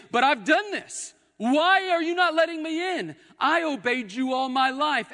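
loud, high-pitched indignant protest; outraged pleading at the top of the voice. Acted. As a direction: cry out at being shut out. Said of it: "you'll be outraged and say".

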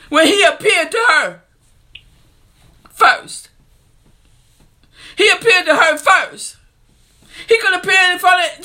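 a fervent preacher who is moved to tears; full-force preaching. Real, not acted. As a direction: preach it up to a shouting climax.

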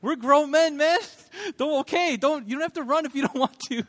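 high-pitched mock disbelief; squeaky-high retold exasperation; incredulous retort. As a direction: protest up high, half laughing. Retelling incredulous reply to friend.

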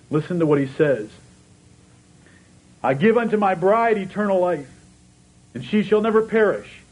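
high, loud, ringing preacher's voice; impassioned sermon delivery quoting Jesus's promise; full-force preaching. Real, not acted. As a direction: preach it out, building with fervour.

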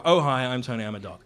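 lightly voiced dog, low and falling away; a hint of a simple dog voice; Tony. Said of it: "his voice is this".

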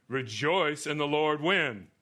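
loud, slow, high call to the congregation; emphatic scripture preaching. Acted. Preacher prompting the congregation to finish the verse, emphatic preaching. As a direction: ring out the verse and toss the question to the room.

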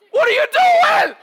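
high, loud laughing outburst; shocked yell. Retold or imitated. Dad shouting in disbelief at the idle kid. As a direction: blurt it out in disbelief.

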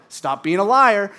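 stern rebuke. Retold or imitated. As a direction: imitate a firm, loud telling-off. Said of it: "the Holy Spirit was like".

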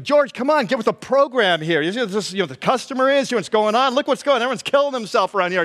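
high, fed-up scolding; irritated. Acted. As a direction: nag a clueless friend in rising irritation. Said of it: "you're just so irritated".

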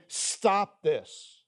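lightly voiced pulpit command, firm and final; preacher's rebuke. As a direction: tell the congregation to stop, mock-stern. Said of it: "This is a preacher saying".

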